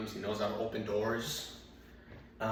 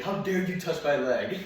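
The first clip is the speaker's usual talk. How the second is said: loud, raised-pitch indignant dog voice; mock screaming; 5 semitones higher, 6 dB louder than usual. Dog whose leg was yanked, mock screaming. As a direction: cry out as the outraged dog. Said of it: "It's like he's screaming".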